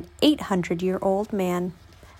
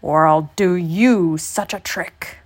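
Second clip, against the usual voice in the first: slow, mildly irritated threat; snapping old man; 3 semitones lower, slower than usual. A narrator's reading. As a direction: spell out the threat slowly. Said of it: "snapped the old man".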